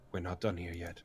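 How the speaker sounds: soft and low, hushed warning. Acted. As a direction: hush him with a low voice. Elf shushes Brog.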